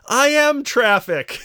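high, drawn-out retort; mock yell back. Cyclist answering the driver's heckle, mock defiant yell. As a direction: imitate a defiant shout back, stretched out slowly. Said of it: "him yelling back".

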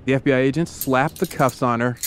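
plain reading voice

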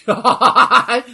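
slow laughing exclamation. Real, not acted. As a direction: laugh through the words. Laughing at stretcher.

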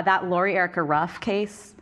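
usual speaking voice

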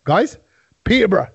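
lightly put-on Indian accent; jokey Indian accent, half-hearted; playful imitation of an Indian accent; mock Indian accent. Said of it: "I don't know why he'd have an Indian accent".

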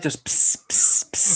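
whispered psst calls; hissed psst. Retold or imitated. Imitating the guy picking up girls, psst whisper. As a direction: call a girl with a sly psst. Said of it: "pick up a girl like that".

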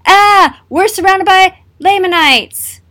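a hammed-up alarmed yell; mock panic. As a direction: wake up and find yourselves surrounded. Soldiers wake up surrounded.

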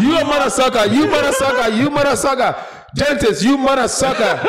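high, loud mock insults; catchphrase shout. Comic re-enacting his stage catchphrase, high mock-abusive shout. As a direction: hurl it at the crowd, high and loud. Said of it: "I would go on stage and be like".

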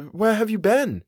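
high-pitched anxious demand; distressed mother. A narrator's reading. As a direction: ask it as an upset mother. Mother confronts the late uncle.

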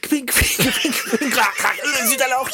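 comic longbow twang. Retold or imitated. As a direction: twang the arrows, then a cartoon French sigh. Comic retelling of the battle.

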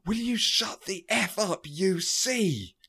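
high, drawn-out mock telling-off; retold exasperated outburst. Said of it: "he just goes".

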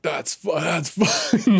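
imagining the first slang inventor, overcome with excitement; overwhelmed exclamation. Retold or imitated. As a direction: blurt it like they lost their shit. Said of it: "they were just like".

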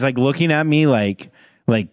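ordinary talk

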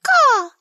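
high, loud bird caw. A narrator's reading. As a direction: squawk out a sudden crow-like caw. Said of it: "with a loud caw".

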